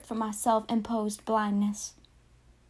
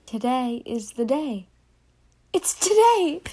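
put-on excited child; 5 dB louder than usual. Child narrator bursting with excitement.

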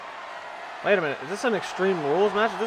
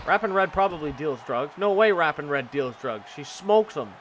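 usual speaking voice

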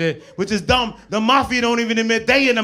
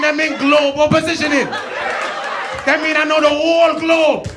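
put-on angry Jamaican accent; 7 semitones higher than usual. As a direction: shout at the driver in Jamaican patois. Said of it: "you won't want no Jamaican G P S".

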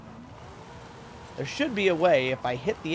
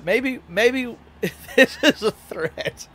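slower, higher, laughing through the words; 7 semitones higher, 6 dB louder, much slower than usual. Real, not acted. Streamer joking back at chat, laughing.

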